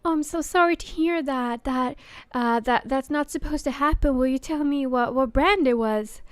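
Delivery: bright, high, overly polite service voice; chirpy helpline voice soothing an angry caller; customer service voice. Retold or imitated. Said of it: "in my customer service voice".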